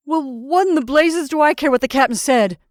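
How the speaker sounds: loud, high, indignant outburst; angry demand. A narrator's reading. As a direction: demand it, incensed. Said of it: "demanded the freckled man".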